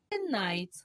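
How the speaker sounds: ordinary delivery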